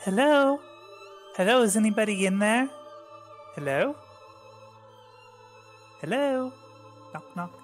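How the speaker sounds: calling into grave. Acted. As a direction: call out like knocking on a door.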